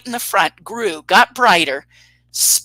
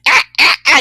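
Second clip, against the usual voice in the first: high, loud mock shrieks; freaked-out yelps; 7 semitones higher, 9 dB louder than usual. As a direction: imitate short panicked screams, one after another. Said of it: "She's totally freaking out".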